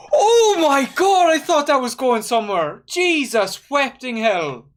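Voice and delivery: high, loud, near-miss excitement; excited outcry. Real, not acted. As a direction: shout it at the screen as the chance almost goes in. Near chance in a match.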